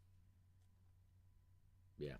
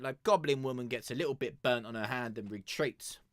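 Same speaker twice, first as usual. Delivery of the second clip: ordinary delivery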